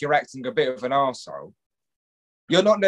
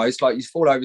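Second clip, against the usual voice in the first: ordinary talk, nothing marked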